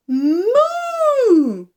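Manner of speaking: high, loud, long-held moo; big cow moo. Shown as a demonstration. Teacher mooing loudly for babies to copy. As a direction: stretch out the moo like a happy cow. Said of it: "The cow says".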